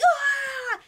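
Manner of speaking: high, held comic scream; alarmed scream. Acted. Boy about to crash into something, alarmed scream. Ash crashes after warning.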